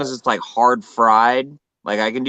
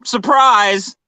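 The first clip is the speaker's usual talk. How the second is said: mock cheery; 13 semitones higher, 5 dB louder than usual. Joking about the poke.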